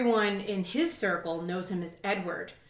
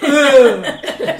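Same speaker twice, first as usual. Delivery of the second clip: loud disgusted ugh; 6 semitones higher, 14 dB louder than usual. Retold or imitated.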